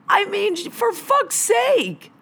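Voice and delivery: slow, high mocking tone; drawn-out sarcastic disbelief. Real, not acted. Sarcastic rant at smoker.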